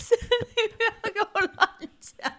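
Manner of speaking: high-pitched laughing scold; laughing at her co-host's teasing; laughing protest. Real, not acted.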